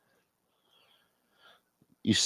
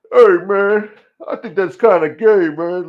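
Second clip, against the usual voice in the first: high, loud character voice; loud heckling; 7 semitones higher, 8 dB louder than usual. Acted. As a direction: butt in loudly, pitched up. Acted character quarrel.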